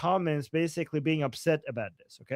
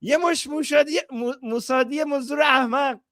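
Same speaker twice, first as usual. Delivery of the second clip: fast, higher shouted Persian insult; mock angry rant; 7 semitones higher, much faster than usual. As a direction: spit the insult out at the trolls. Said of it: "and he's saying".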